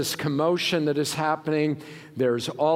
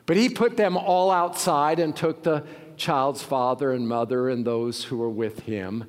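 ordinary talk, nothing marked

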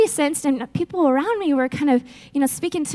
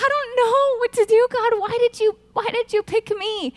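higher, half-laughing helpless plea; clueless plea; 7 semitones higher than usual. Retold or imitated. New team leader asking God why, half-laughing helpless plea. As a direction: throw it up to God, mock bewildered. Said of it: "but I was clueless".

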